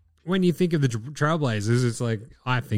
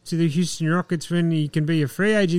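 deep nerd voice; 4 semitones higher than usual. Retold or imitated. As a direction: do a dorky insider voice. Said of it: "in my deep nerd voice".

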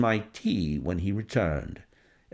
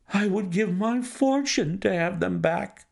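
distressed banker; 8 semitones higher than usual. Acted. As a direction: recoil from the idea, aghast and pleading. Banker voiced by reader.

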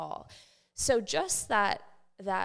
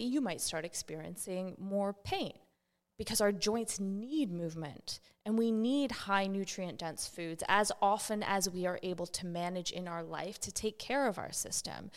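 ordinary talk, nothing marked

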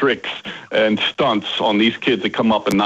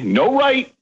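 calling out; 12 semitones higher than usual. Retold or imitated. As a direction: call it to the kids at the bus stop. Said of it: "And I would say".

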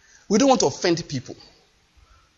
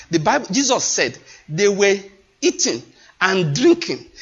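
normal talking voice

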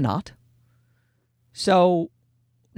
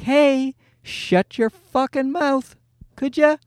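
put-on sweet, higher, slow baby-soothing voice; mock-tender singsong, pitched up; non-aggressive tone; 6 semitones higher, slower than usual. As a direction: coo the insult gently, as if to a baby. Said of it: "don't say it in an aggressive tone".

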